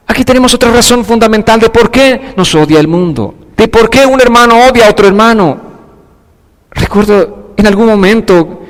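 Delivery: ordinary talk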